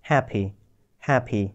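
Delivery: হ্যাপি ending in a short i, not e; final short i. Shown as a demonstration. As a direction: say হ্যাপি with a clipped i at the end. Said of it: "এখানে এ বলিনি আমি".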